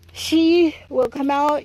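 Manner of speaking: ordinary talk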